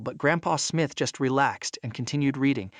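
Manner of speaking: ordinary delivery, nothing marked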